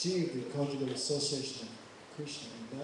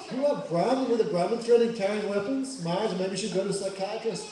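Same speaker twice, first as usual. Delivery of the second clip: loud mocking; 4 semitones higher, 8 dB louder than usual. Lakshman mocking loudly.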